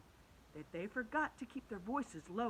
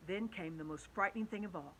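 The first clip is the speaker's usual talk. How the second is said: usual speaking voice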